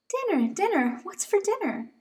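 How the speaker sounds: high, bouncy little bunny voice; eager little bunny. Acted. Dozy asking Mama what's for dinner, high eager bunny. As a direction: chant it like a hungry toddler.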